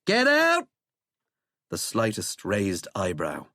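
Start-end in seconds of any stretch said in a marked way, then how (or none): 0.0-0.7 very high, loud bark; furious yell. A narrator's reading. President ordering Withering out, furious yell. President yells at the remaining man.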